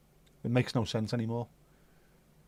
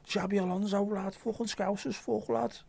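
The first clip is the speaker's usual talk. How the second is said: much higher broad mock Scouse accent; 8 semitones higher than usual. Mocking Scousers in a broad Scouse accent. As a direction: ham up the Scouse lad voice. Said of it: "going back to being an Uber Scouser".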